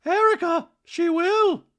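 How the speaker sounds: very high, loud put-on voice; mock insistent retort. Dorit answering Erica, mock insistent. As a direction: imitate a housewife sure of herself.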